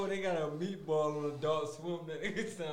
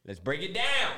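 ordinary talk, nothing marked

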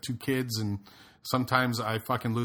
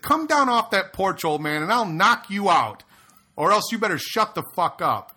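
retold shouted threat; 8 semitones higher, 6 dB louder than usual. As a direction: call him out to fight.

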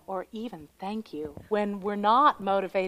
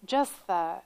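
ordinary delivery

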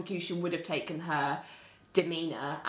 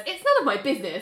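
mock busybody; 7 semitones higher, 6 dB louder, faster than usual. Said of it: "she says".

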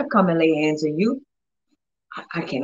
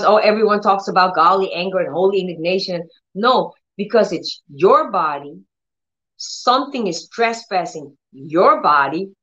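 ordinary talk, nothing marked

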